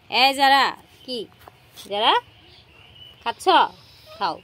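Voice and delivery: loud call to the cat; calling a pet. Real, not acted.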